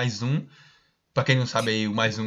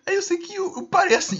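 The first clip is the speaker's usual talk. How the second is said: rindo. Real, not acted. Ri ao contar a cena.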